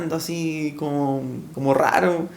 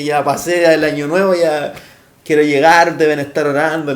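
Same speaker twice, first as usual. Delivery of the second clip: eager and louder, rushing to share good news; joyful louder thanks; excited loud prayer; 7 dB louder than usual. Retold or imitated. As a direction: tell God you made it, voice swelling. Eager to tell the church.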